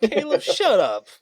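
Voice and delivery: shouting through laughter; laughing loud retort. Real, not acted.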